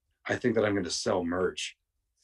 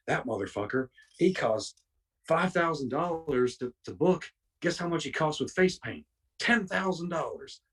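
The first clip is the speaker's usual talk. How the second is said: mildly indignant, higher-pitched grumbling; retold rant, pitch jumping on the prices; worked-up rant; 7 semitones higher than usual. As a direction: imitate an old wrestler getting worked up. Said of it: "get all worked up".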